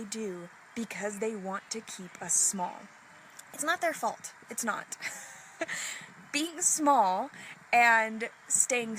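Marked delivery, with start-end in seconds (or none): none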